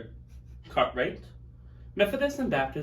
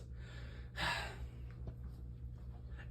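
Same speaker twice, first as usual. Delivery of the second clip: sigh; 17 dB quieter than usual. Acted. Sad farewell to murdered founder.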